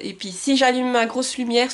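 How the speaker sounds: normal talking voice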